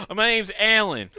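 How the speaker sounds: put-on high, laughing mobster Italian accent; fake Italian accent. The fake gangster introduces himself to old neighbors in an Italian accent. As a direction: slip the name out in a hammed-up Italian accent. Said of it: "fake Italian accent".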